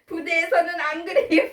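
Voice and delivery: high-pitched, laughing protest; laughing reply. Retold or imitated. Son answering his nagging parent, laughing. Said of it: "이렇게 하면 웃으면서".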